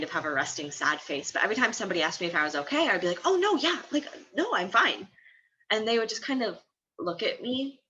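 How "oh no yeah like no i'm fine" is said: high-pitched, hasty brush-off; flustered reassurance. Retold or imitated. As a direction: wave away concern in a high, defensive voice. Acting out her flustered reply.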